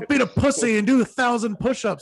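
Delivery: over-the-top mock bravado; mock gym bro. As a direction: ham up the extreme workout zealot. Parodying hardcore fitness talk.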